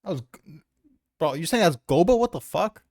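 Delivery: ordinary delivery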